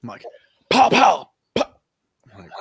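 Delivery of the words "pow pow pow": loud, high gunfire noises; imitated gunshots. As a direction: fire off voiced shots, punched loud. Imitates the gun firing.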